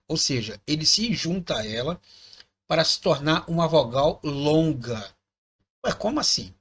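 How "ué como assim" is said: mock puzzled student.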